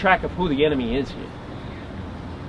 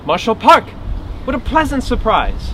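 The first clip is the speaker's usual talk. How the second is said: higher-pitched voice of a surprised woman; polite hostess; 6 semitones higher than usual. Acted. Hawaiian woman answers door to marshal, pleasantly surprised. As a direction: greet an unexpected guest with delight.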